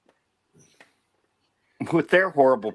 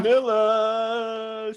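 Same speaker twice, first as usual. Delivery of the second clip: drawn-out name; 5 semitones higher, much slower than usual. Acted. Echoing the name.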